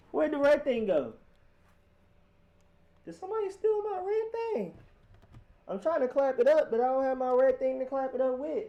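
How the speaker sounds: high, squeaky comic voice searching for his red thing; squeaky put-on voice. Streamer to chat, hunting for his red thing in a squeaky comic voice. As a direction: play it as a panicky little cartoon voice.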